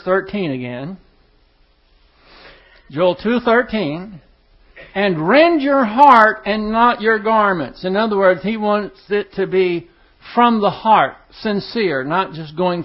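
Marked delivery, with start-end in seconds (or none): 4.9-7.8 solemn scripture reading. Acted. Verse read solemnly, slowed.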